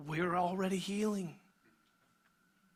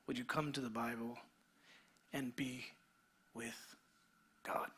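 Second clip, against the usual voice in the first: hushed closing invitation; 5 semitones lower, 7 dB quieter than usual. Real, not acted. Pastor inviting the congregation to pray, hushed and tender. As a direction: draw them gently into prayer.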